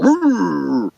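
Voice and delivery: high excited yelp; be the dog eager to chase; voiced dog. Voicing the dog chasing cat.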